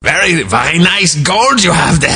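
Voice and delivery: put-on heavy Russian accent, high and halting. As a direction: imitate an older Russian assistant. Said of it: "she really has a real heavy Russian accent".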